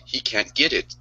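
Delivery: ordinary talk, nothing marked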